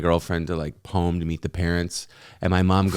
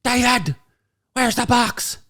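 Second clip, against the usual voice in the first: much higher shrill scolding mom voice. Retold or imitated. Mom scolding dad over the forgotten box, high nagging. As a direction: nag him in a high mother's voice. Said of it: "My mom goes".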